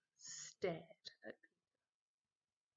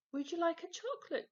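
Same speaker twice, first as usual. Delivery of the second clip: higher, louder and fast, jittery; nervous offer; 11 semitones higher, 8 dB louder, faster than usual. Acted. Peter offering chocolates to Mum, fast and jittery. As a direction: rush it out with nerves.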